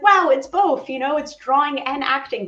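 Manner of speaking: high, loud burst of retold delight; excited wow. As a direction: relive the thrill of discovering it. Recalls discovering animation, delighted.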